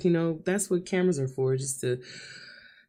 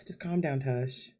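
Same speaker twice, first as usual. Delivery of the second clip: normal talking voice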